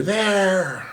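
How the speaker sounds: high sharp call from afar; distant shout. Retold or imitated. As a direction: point and yell. Someone shouting from the dark.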